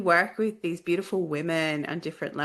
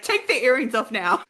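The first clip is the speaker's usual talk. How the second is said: higher, louder playful order; mock urgent command; 6 semitones higher, 5 dB louder than usual. Server's words retold as a command.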